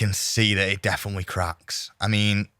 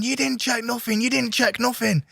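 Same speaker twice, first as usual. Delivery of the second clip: much higher, hammed-up heckling voice; mock Conor heckle; 14 semitones higher than usual. Conor heckles Dustin's interview from the background, high mock protest. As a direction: squeal it like a furious heckler off to the side. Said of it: "hear Conor in the background going".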